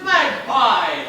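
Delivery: loud, ringing hello; joyful greeting shout. Acted. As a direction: call out in delight.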